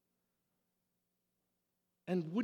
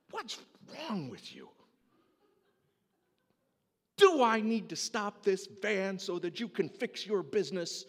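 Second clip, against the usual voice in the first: loud scolding yell; fed-up youth leader yelling at kids; shouting at kids; 4 semitones higher, 10 dB louder than usual. Retold or imitated. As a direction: bark it over the noise of the van. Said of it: "I'm shouting it at many decibels".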